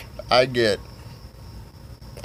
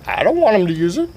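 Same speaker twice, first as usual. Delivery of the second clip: high-pitched retold objection; alarmed protest; 8 semitones higher than usual. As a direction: protest in a raised, put-on voice. Acted protest to Steve.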